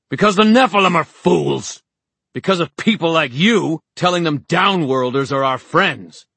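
high, loud ranting outburst; shouted, high-pitched fury; enraged tirade. A narrator's reading. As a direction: rant furiously at a crowd. Horace's screamed tirade goes on.

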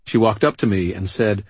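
usual speaking voice

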